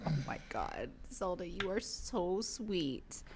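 slow, soft cooing at the dog; soft dog talk. Real, not acted. Talking to Zelda the dog, soft slow cooing. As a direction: melt over a sweet pet.

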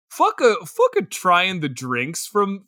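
usual speaking voice

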